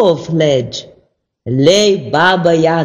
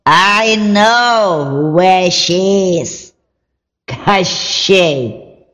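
slow, creaky witch voice; 2 semitones lower than usual. Acted. Baba Yaga tells Ivan about Koschei, witch voice. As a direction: croak it like an old witch. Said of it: "exclaimed the witch".

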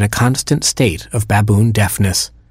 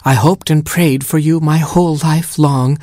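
put-on higher, tender murmur to the baby; murmured tenderly; 6 semitones higher than usual. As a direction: breathe it lovingly to the newborn. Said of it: "she murmured to her baby".

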